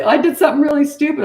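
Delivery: loud, high-pitched retold admission; high, exclaimed confession with amusement; mock confession. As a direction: blurt it out as an imitated client. Acts out clients confessing a mess-up.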